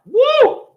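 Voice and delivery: sudden high-pitched "woo"; excited sound-effect whoop. Retold or imitated. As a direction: let out a quick high whoop. Whoop as gun is pulled out.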